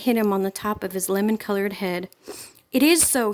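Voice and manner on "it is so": high, loud shout. A narrator's reading. Bully shouting back up at Felina in the tree, loud shout. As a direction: shout it right back at her. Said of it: "he shouted".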